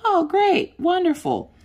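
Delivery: high, sing-song mock delight; sarcastic. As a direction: fake cheer, dripping with sarcasm. Sarcastic reaction to husband's plan.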